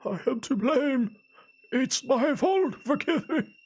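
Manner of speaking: put-on high, slow, wailing old man; sobbing old man. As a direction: wail it out in guilt. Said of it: "began to sob once more".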